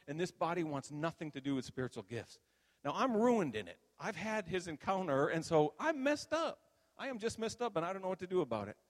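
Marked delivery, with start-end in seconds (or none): 2.8-8.8 high-pitched, overwrought complaint; distressed lament. Retold or imitated. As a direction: wail it high, at a loss. Retelling being messed up, distressed.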